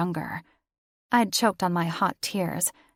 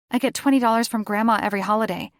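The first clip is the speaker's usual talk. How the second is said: fast, higher and louder; rushed excuse; 4 semitones higher, 6 dB louder, much faster than usual. A narrator's reading. Daughter scrambles to explain the money, fast and nervous. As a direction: blurt it out nervously.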